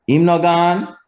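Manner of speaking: HIM NO GONE high, rising on GONE; contrasting intonation. Shown as a demonstration. Teacher showing "he has indeed left", high rising intonation. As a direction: lift HIM NO GONE up at the end like a question. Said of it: "One means he has indeed left".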